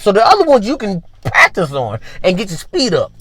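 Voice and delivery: ordinary talk, nothing marked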